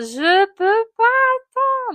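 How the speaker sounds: long, high, desperate scream. Retold or imitated. Woman in labour screams she can't wait, full scream. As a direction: wail it out, unable to hold on. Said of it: "Et là, je hurle".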